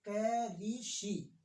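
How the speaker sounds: कै री शी broken into slow separate syllables. Shown as a demonstration. Teacher breaking कृषि into slow syllables for pupils. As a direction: spell out कृषि slowly as कै री शी. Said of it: "कृषि".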